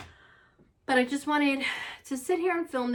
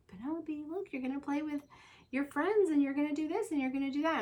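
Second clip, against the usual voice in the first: put-on higher, bright coaxing to a crying child; a light mom voice soothing her daughter; 7 semitones higher, faster than usual. Mom coaxing crying daughter at drop-off, higher and sing-song. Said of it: "and I'm like".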